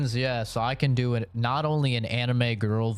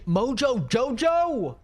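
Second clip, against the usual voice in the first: squeaky high call; 13 semitones higher, 4 dB louder, slower than usual. Acted. Calling out a name.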